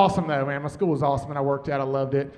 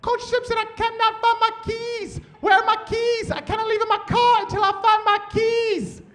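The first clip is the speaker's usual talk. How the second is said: much higher, same frantic teacher voice repeated; retold teacher mimicked in a much higher voice, asking for the lost keys; frantic teacher slowed down; 14 semitones higher than usual. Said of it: "Let me slow that down for you".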